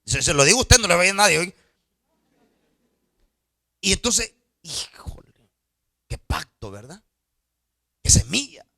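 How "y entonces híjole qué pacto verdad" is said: hushed whisper, barely voiced. Real, not acted. Pastor confiding to congregation, whispering. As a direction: drop to a secret whisper. Hushed aside about the secret.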